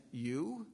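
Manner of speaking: "You?" stretched with a rising question tune; emphatic questioning you. Acted. Pilate looking at Jesus and saying, emphatic, questioning "You". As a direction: lean on "You" as a doubting question. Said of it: "is emphatic".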